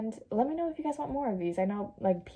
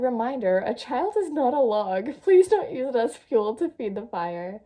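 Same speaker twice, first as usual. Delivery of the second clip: mock announcement; 3 semitones higher, 7 dB louder than usual. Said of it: "Someone said".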